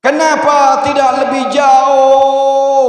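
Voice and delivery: high, stretched-out wail of a question; dying man's shout. Acted. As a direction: act out a dying cry, dragging the last word. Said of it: "suami saya ini bengok-bengok". (teriakan sekarat yang melengking panjang)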